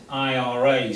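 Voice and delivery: I R A read out as three separate letters; spelled-out initials. Shown as a demonstration. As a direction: say each letter of I R A by its English name. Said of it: "This is always pronounced as".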